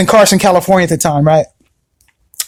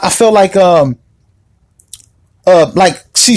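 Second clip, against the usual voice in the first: usual speaking voice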